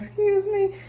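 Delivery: a mother checking her son's manners; stern mom. Retold or imitated. Mom correcting her son, mock stern high challenge. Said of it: "She's like".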